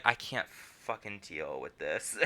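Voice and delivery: low, quiet exasperated mock aside; exasperated straight man. Katara fed up with everyone's accent, low exasperated aside. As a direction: drop low and soft, acting fed up. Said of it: "Tara's like".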